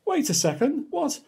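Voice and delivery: high, rising wait a second; startled reader, voice jumping up; baffled surprise. Acted. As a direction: squeak out the what in disbelief. Reader baffled by silly text.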